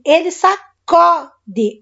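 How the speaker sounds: "sacode" drawn out very slowly with an open "ó". Shown as a demonstration. Teacher to students, slowly showing the open O in sacode. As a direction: stretch "sacode" so the open O rings out. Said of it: "Olha o O aberto lá".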